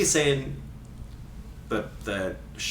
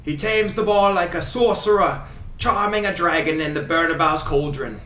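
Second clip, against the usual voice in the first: put-on Ray Hudson Scottish accent; 2 semitones higher than usual. Host reading commentary quotes for guessing, in fake Scottish accent. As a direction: read it as a grand Scottish football commentator. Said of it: "doing his accent".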